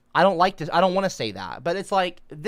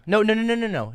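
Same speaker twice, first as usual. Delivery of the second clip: usual speaking voice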